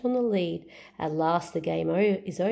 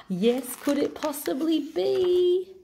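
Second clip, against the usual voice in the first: slow rising suspense; 9 semitones higher than usual. A narrator's reading. Suspense before Stick Man.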